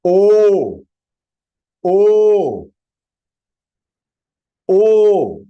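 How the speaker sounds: O held long as a loud, steady "oooh"; triple-length vowel. Shown as a demonstration. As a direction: stretch each O out to three beats. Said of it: "O three times".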